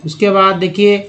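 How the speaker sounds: usual speaking voice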